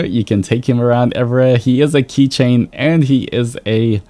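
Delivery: ordinary talk, nothing marked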